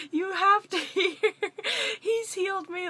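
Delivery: grabbing a stranger's attention in delight; excited. Retold or imitated. To each person passed, excited and high. Stopping every person to share.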